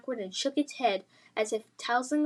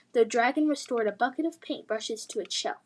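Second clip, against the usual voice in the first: ordinary delivery, nothing marked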